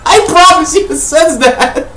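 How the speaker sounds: high, giggling through the words; can't keep a straight face, laugh while talking. Real, not acted. Laughing at his own joke.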